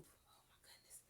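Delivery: breathless whisper. Real, not acted. Reader whispering to the children in suspense.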